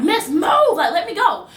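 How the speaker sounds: high-pitched, insistent retold protest; insistent demand. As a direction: act out demanding to be let go.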